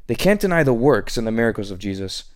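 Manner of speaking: ordinary delivery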